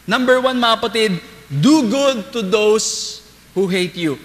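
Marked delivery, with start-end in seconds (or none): 1.5-4.1 slow emphatic reading. Shown as a demonstration. Binabasa ang utos ng Diyos.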